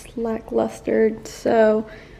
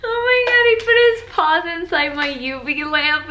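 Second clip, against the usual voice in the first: higher, louder amused exclamation; surprised; 7 semitones higher, 7 dB louder than usual. Real, not acted. Telling viewers the dog put his paws in her lamp, surprised. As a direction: exclaim in delighted disbelief.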